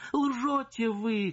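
high, slow furious cry. Acted. Wife to the singer, high slow furious cry. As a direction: fling the accusation at her. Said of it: "крикнула барыня".

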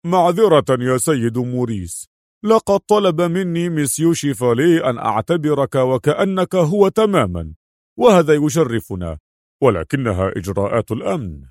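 lightly voiced director making excuses; apologetic director. A narrator's reading. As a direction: apologise formally, as a deferential official. (مدير يعتذر برسمية)

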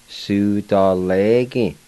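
Su da le gi drawn out slowly with weight on le; slow demo. Shown as a demonstration. Teacher pronouncing Su da le gi slowly for students. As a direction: spell Su da le gi out slowly for learners. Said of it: "And now I'll go back and pronounce them".

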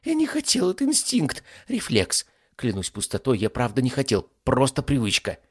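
high, frantic excuse-making; frightened demon. Acted. Caught ifrit apologises to his master, frightened. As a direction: start very high, then babble excuses.

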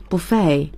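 buffet ending on "ay", T left silent; French-style "buffet" with no T sound; silent final T. Shown as a demonstration. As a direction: say "buffet" as "buh-FAY". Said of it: "最后的T不发音".

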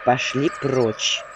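low, slow, sulky brush-off; a resentful, drawn-out order to leave; offended dismissal. Acted. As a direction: send her away in a huff. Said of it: "обиженно заметила я".

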